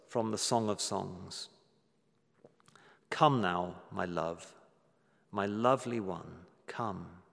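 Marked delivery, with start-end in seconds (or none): 3.1-7.2 tender call. Retold or imitated. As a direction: beckon a loved one gently, sinking to a hush. Quoting God's loving call to her.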